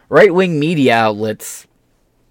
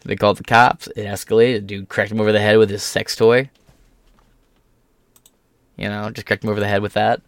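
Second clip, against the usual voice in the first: usual speaking voice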